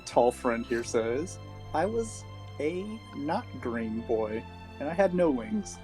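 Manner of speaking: ordinary delivery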